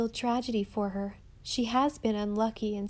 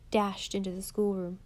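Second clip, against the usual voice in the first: ordinary delivery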